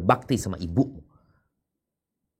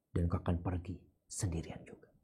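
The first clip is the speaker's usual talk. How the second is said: whisper; 11 dB quieter than usual. Acted. Wife's tender words to husband.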